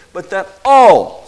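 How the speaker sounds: "all" punched high, loud and long; stress on ALL. Shown as a demonstration. Preacher repeats the verse to prove a point, hammering "all". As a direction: blast the word "all" and stretch it out. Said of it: "those inclusive large words".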